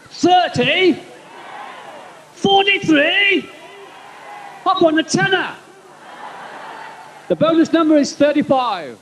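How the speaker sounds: high, excited lottery caller shouting numbers; lottery announcer. Acted. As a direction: call the draw like a hyped host. Comic mimics lottery announcer.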